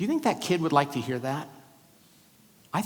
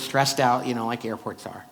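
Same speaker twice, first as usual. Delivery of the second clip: ordinary talk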